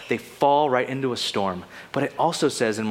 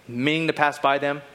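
usual speaking voice

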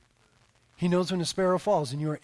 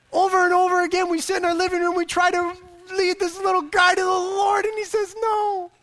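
high, loud, straining complaint; comic exasperation; 11 semitones higher, 5 dB louder than usual. Real, not acted. Father telling congregation his son keeps refusing, exasperated. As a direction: climb high as if at your wits' end.

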